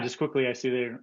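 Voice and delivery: normal talking voice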